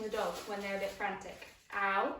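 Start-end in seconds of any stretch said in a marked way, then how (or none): 1.7-2.1 real ouch. Real, not acted. Puppy bites her hand.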